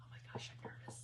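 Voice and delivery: nervous whisper; anxious whisper before stamping. Real, not acted. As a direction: whisper it, scared to jinx it.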